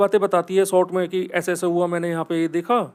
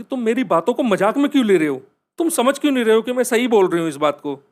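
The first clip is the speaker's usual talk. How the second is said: higher, louder, rushed complaint; frustrated protest; 4 semitones higher, 5 dB louder, faster than usual. Retold or imitated. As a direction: push back hard at being mocked. Tanvi upset, not believed.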